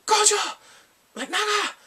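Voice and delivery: high, loud outburst; angry mock shout. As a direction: yell it in imitated rage. Acts out man's angry outburst.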